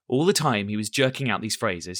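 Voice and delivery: ordinary delivery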